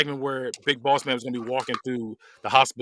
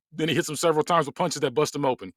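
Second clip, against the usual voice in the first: plain reading voice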